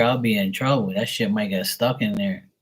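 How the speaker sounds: normal talking voice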